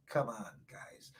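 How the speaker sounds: low, very soft plea; quiet, confiding nudge; hushed coaxing. Real, not acted. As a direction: coax listeners almost under your breath.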